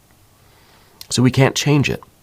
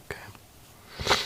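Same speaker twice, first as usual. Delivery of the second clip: breathless whispered okay, almost no voice; emotional whisper; 15 dB quieter than usual. Real, not acted. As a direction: let the word slip out as a whisper while fighting tears. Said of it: "this is getting me a little emotional".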